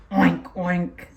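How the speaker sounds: low snorty oink; pig oink. Shown as a demonstration. Teacher oinking for preschoolers.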